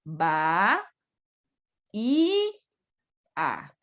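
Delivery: "Va, y, a" as three separate syllables with pauses; split into syllables. Shown as a demonstration. Teacher splitting bahía to show the hiato, syllable by syllable. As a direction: pull bahía apart into ba-í-a. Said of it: "Es un hiato".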